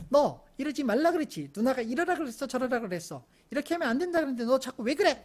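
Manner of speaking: acted exasperated scolding; imitated sisterly scolding. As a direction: tell the little ones off like a bossy big sister. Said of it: "혼내더라고요".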